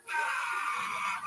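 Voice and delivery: loud short outburst; playful shout. Real, not acted. Reacting to the party gossip with a loud shout. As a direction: shout it in mock outrage. Teasing about the party invite.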